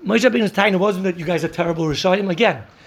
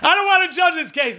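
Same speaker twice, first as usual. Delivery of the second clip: mock scared judge; 8 semitones higher than usual. Judge afraid of the tough guy, mock frightened whine. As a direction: play a judge backing away from the case.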